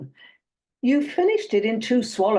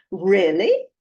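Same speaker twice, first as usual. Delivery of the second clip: a raised-eyebrow "Really?", punched louder with a rising end; quizzical interest; 5 dB louder than usual. Acted. Dater feigning interest to her date, quizzical. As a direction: act intrigued, questioning and loud. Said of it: "quizzical high arch accompanied by".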